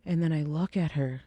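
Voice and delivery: normal talking voice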